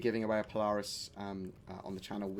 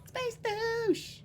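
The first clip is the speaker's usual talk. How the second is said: mock high squeal. Repeating the taunt.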